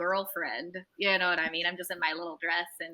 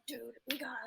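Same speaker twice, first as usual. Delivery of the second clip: soft, half-whispered nudge to a friend; hushed urging; 4 semitones higher, 14 dB quieter, much faster than usual. Retold or imitated. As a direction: whisper it in awe of someone nearby. Urging a friend near an idol.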